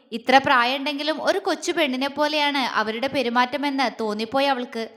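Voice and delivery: ordinary delivery, nothing marked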